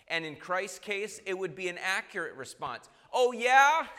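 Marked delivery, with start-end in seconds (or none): 3.1-4.0 loud, high comeback, breaking into a chuckle; hammed-up reviling in return; mock angry retort. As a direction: fire back like someone picking a fight. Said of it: "would be an angry response".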